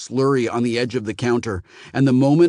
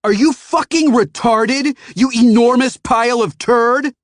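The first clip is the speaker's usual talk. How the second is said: furious yell; 13 semitones higher, 8 dB louder than usual. A narrator's reading. Phoenician yells insults at the kid, furious. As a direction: yell abuse at him. Customer yelling at teen.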